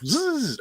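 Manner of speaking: high buzzing zip sound for a fast scroll; quick high-pitched zzz; zipping scroll noise. Retold or imitated. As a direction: mimic a wheel spinning fast, way up high. Imitates mouse wheel scrolling.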